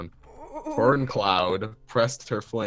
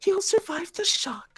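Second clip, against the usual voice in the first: much higher, louder cat voice; high character voice; 5 dB louder than usual. Acted. Character voice in read story.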